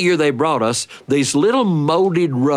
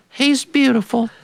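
high, far-off doting voice; doting mother; 12 semitones higher than usual. Retold or imitated. Mother answering her sisters, high and doting. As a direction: sigh it out like a besotted mother. Mother dotes on son's curls.

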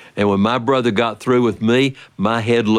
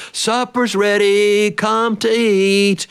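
higher, slow sing-song call across the house; mother calling; 10 semitones higher, much slower than usual. Retold or imitated. Mother calling the children to supper, slow and high. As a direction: stretch it out like calling kids in.

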